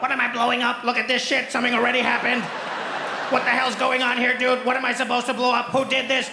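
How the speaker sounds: lightly voiced puzzled alien; confused alien pilot. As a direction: whine about the missing target.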